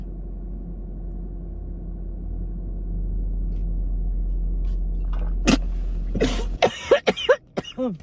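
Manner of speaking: violent choking cough fit; coffee down the wrong pipe, hacking; coughing up water. Real, not acted. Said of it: "That was dramatic".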